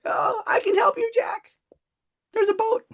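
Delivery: hammed-up, very high plaintive cry; Titanic parody. Coach calling to Jack about boat, high Titanic parody. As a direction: squeal it like a mock film heroine. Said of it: "I couldn't resist. Sorry, I, Titanic".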